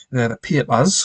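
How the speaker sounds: Geordie 'papers', said as pee-pus; Geordie accent. Shown as a demonstration. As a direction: imitate Geordie speech on papers. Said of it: "Geordie people say papers instead of papers".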